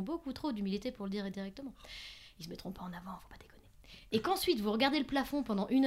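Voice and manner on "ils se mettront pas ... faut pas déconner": whispered aside. Real, not acted. Confiding aside to audience.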